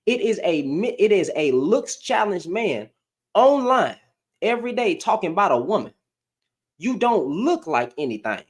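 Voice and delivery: normal talking voice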